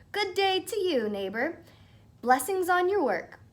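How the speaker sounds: high, sugary courtesy; butter up the little man; overly polite greeting. Acted. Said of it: "tipped his hat politely".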